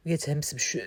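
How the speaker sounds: ordinary delivery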